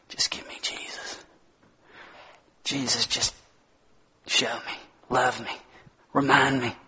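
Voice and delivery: soft whispered longing; whispered plea. Acted. Believer in trouble pleading for Jesus, whispered. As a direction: whisper it like a prayer. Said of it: "repeats the plea himself".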